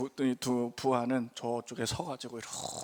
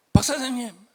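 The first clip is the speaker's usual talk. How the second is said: a light voicing of the boss, pitched up and louder; gang boss on the phone; 8 semitones higher, 7 dB louder than usual. Gang boss phoning the pastor, a light pained call. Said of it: "갑자기 전화가 왔어요".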